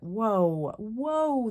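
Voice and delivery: rising alarmed whoa, whoa; surprised whoa. Retold or imitated. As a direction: pull back in mock shock. Shocked by inappropriate film scene.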